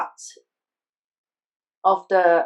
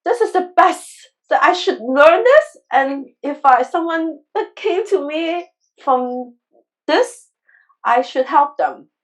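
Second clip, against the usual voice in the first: higher and louder, bursting with conviction; excited declaration; 4 semitones higher, 4 dB louder than usual. Retold or imitated. Telling Deborah she must learn this surgery, excited. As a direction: tell her this is the best thing ever. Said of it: "I told Deborah".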